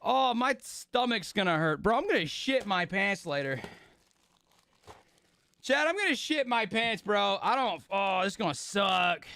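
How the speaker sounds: ordinary delivery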